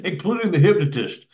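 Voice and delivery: normal talking voice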